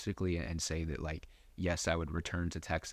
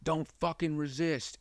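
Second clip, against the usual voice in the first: retold cop's barked order, higher; cop yelling; 9 semitones higher than usual. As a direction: shout it down at someone pinned. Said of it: "I remember hearing".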